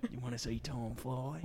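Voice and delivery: quiet replay. Shown as a demonstration. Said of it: "It's not bad, yeah".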